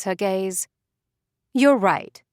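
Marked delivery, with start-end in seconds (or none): 1.5-2.2 pointed. A narrator's reading. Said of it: "says Priscilla pointedly".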